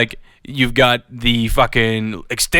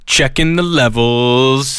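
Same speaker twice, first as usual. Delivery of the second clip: slow mic check; much slower than usual. Pretend.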